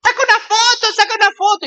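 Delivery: very high imitation of girls calling down; mock excited shouting. Girls yelling to their aunt below, high mock shouting. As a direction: call out excitedly in a high put-on voice. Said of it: "le empiezan a gritar".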